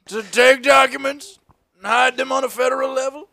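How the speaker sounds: high, loud mock Trump voice; a boastful leader in a put-on voice; Trump impression. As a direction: ham up a smug president.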